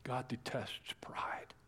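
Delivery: slow, solemn whisper; whispered warning. Acted. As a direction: lean in and whisper it slowly. Solemn point after the reading.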